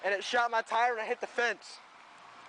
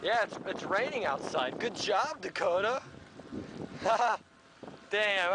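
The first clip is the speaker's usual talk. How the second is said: ordinary talk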